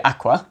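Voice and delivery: "aqua" said as Italian AH-kwah; Italian word. Shown as a demonstration. Teacher demoing a word to a learner, Italian "aqua". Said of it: "in Italian".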